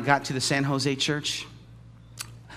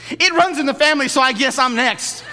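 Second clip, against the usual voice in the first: mock complainer; 9 semitones higher, 8 dB louder than usual. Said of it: "mock believer complaining".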